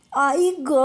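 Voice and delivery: sudden high yelp of pain after a fall; cry of pain. A narrator's reading. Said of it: "विव्हळण्याचा जोरात आवाज आला".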